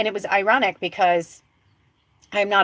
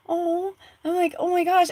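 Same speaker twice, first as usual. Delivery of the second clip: retold awe; 11 semitones higher than usual. Retelling her awe watching him.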